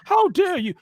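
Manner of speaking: high indignant how dare you; mock Greta Thunberg outrage. Mocking men whining like Greta Thunberg, high and indignant. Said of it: "they turn into Greta Thunberg's".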